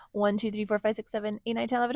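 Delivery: ordinary delivery, nothing marked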